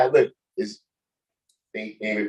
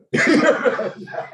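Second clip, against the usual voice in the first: high laugh; 12 semitones higher than usual. Real, not acted. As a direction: burst of high giggling. Laughing at the news drama.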